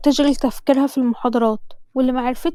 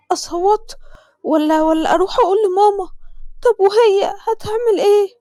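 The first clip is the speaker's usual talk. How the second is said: panicked; 7 semitones higher than usual. Acted. Witness panics after murder.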